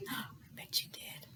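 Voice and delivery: soft secret whisper. Acted. Librarian whispering an aside to the kids, hushed. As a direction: lean in and whisper it.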